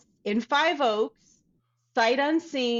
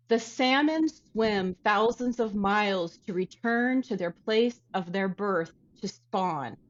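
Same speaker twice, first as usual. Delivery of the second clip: normal talking voice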